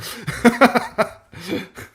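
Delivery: loud, high burst of laughter; big amused cackle. Real, not acted. Laughs at salvo joke.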